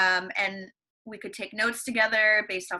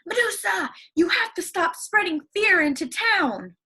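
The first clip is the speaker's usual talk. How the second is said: much higher, louder heroic call; 9 semitones higher, 4 dB louder than usual. Acted. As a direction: call the monster out bravely. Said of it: "He called out".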